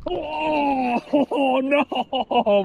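pained cry with a laugh; wincing after a groin hit; just hit in the groin. Real, not acted.

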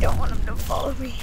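ordinary talk, nothing marked

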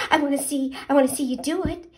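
can't wait to watch; excitedly. Acted. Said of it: "the pollywog said excitedly".

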